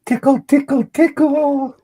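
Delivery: very high, loud squeaky voice; squeaky tickle voice. Acted. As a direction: squeal it like a playful cartoon tickler. Playful tickle call on show.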